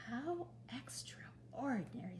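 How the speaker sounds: slow breathy whisper of amazement; hushed wonder. Acted. Mister Watson rocking the piglet, slow hushed whisper. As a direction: whisper it so the baby piglet sleeps on.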